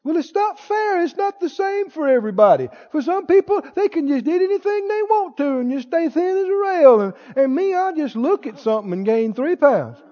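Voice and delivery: hammed-up poor-me complaint; self-pitying excuse-maker. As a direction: plead how unfair it all is. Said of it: "one of the awfulest confessions".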